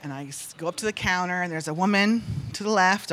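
ordinary talk, nothing marked

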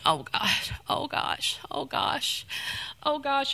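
soft, breathy moans of someone in pain; pained moaning. Retold or imitated. Woman in the dream holding her head, moaning softly in pain. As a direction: moan it quietly as if your head is splitting.

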